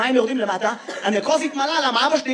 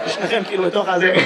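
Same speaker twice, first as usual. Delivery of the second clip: normal talking voice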